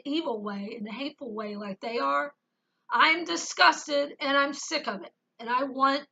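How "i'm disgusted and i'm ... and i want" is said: normal talking voice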